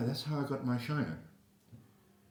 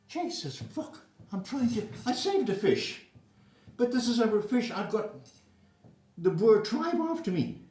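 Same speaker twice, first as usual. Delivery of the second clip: panicked; 8 semitones higher, 4 dB louder than usual. Retold or imitated. Panicked inner thought fleeing mob.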